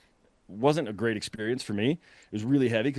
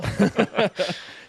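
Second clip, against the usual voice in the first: laugh; 10 semitones higher, 3 dB louder than usual. Real, not acted. Laughs at own joke.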